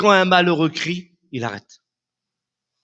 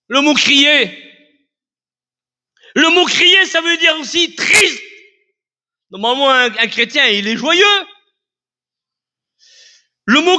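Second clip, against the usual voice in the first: loud, high, impassioned sermon; fiery pulpit preaching; preaching at full force; 6 dB louder than usual. Acted. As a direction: preach it out, climbing and hammering each line.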